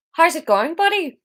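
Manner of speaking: high-pitched, off-target Irish attempt; failed Irish accent. Shown as a demonstration. Reader trying Theo's greeting, badly missed Irish accent. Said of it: "That's not an Irish accent".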